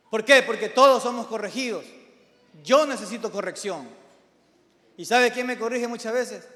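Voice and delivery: loud, high-pitched pulpit exhortation; impassioned preacher at full voice; forceful preaching. Real, not acted.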